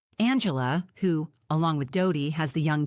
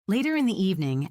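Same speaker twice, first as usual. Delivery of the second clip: usual speaking voice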